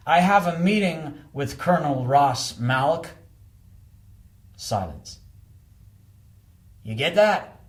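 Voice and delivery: the visitor holding back his temper; American visitor. Acted. Al insisting to the doorman, firm clipped patience.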